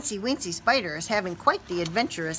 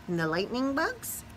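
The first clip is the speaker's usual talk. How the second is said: ordinary delivery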